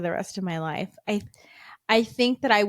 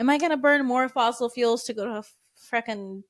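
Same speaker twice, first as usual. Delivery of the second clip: mock rant; 4 semitones higher, 4 dB louder than usual. Ranting about going to festival.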